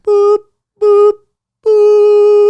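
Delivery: very high, loud boop sounds; mock beeps. Someone in the background mocking with high loud boops. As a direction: chirp it like a silly horn. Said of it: "someone in the background is like".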